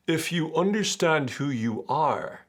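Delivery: slow, lofty profound-sounding voice; guru parody. Pretend. Mocking spiritual gurus with slow lofty vagueness. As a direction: intone it like a self-help guru.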